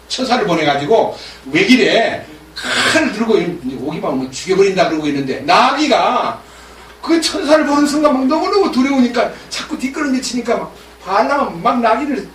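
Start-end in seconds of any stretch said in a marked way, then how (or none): none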